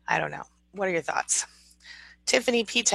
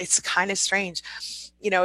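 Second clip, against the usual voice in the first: normal talking voice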